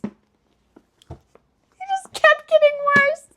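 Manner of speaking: high, slow drawn-out whine; mock despair, high and drawn out; whiny lament. Real, not acted. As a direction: stretch it into a wailing lament. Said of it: "and it just kept getting worse".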